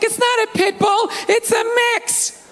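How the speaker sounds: high, defensive mock girlfriend voice. Girlfriend defending the dog to him, mocked high. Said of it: "She's like".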